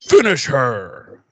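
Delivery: slow, drawn-out game announcer call. Acted. Co-host finishes the fatality joke, as game announcer. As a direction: draw it out like a fighting game announcer.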